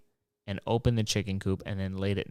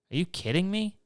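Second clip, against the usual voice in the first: incredulous; 9 semitones higher than usual. Real, not acted. Incredulous at homemade robot.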